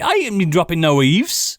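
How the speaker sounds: lightly put-on West Country yokel; a hint of rural West Country, slowed; mock hobbit drawl in West Country. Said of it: "talk like a hobbit".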